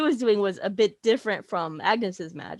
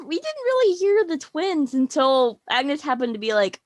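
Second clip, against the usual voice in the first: ordinary talk, nothing marked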